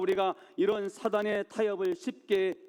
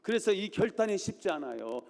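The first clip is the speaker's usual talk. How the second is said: normal talking voice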